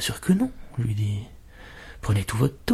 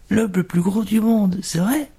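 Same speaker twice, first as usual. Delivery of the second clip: eager curiosity; 9 semitones higher than usual. A narrator's reading. Cook asks about fat customer.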